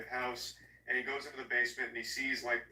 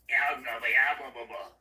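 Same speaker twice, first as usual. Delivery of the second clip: loud, silly fried demon growl; a kid's cheesy monster voice, played for laughs; goofy demon voice; 7 dB louder than usual. Possessed boy taunting his mother, in a goofy demon voice. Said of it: "vocal fry yourself and then try and make it deeper".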